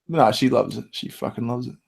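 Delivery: ordinary delivery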